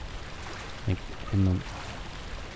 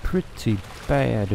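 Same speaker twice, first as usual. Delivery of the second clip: slow, softer, stretched-out words; drawn-out repeat; 4 dB quieter, slower than usual. Pretend. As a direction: stretch each word out slowly and deliberately.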